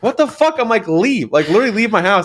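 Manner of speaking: high-pitched mock indignation; retold outrage. Child reacting to a friend's claim, mock outrage. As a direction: replay the outburst high, without shouting.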